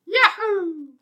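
very high cartoon jump cry; Mario yahoo. Joining the Mario voice bit, very high cartoon yahoo. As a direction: whoop it like Mario jumping.

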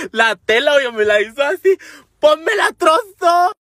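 high, loud indignant cry; outraged yell. Retold or imitated. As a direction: shout it like the loss still stings. Retelling him ripping the underwear.

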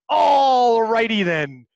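drawn-out catchphrase impression; slow, hammed-up catchphrase; Jim Carrey. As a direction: stretch it out like a comedian's signature line. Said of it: "name was Jim Carrey".

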